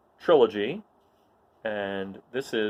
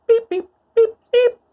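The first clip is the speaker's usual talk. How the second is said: very high, loud droid beeping; squeaky electronic beeps; 12 dB louder than usual. Acted. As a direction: chirp like a little astromech. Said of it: "he's arguing with C three P O".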